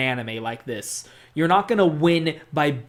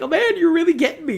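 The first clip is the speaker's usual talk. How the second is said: high, fast, overwhelmed gush; playing a player won over by the acting; mock gushing; 13 semitones higher, 4 dB louder, faster than usual. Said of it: "It's like".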